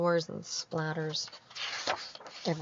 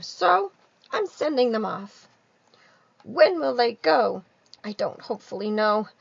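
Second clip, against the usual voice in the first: Lorax voice; 7 semitones higher, 13 dB louder than usual. Acted. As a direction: sadly send the swans away. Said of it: "said the Lorax".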